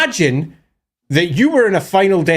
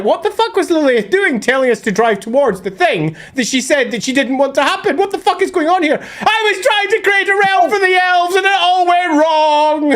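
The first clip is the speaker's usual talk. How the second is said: mock outrage; 9 semitones higher than usual. The elves complaining about Lilith, hammed-up outrage. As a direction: rant at being betrayed. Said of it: "everyone's like".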